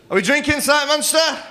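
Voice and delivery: high rousing shout; rally the whole room with a shouted question; calling to the crowd. Real, not acted.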